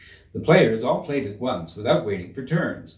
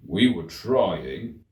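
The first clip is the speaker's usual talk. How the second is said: slow, low meek excuse; humble gardener; 3 semitones lower, much slower than usual. A narrator's reading. Gardener answers the queen, slow meek voice.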